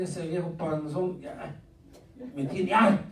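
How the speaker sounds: soft, slow resentful grumble; grumbling churchgoer. Pretend. Angry member complaining about the pastor, slow muttered grumble. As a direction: grumble it under your breath at the pastor. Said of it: "va a decir".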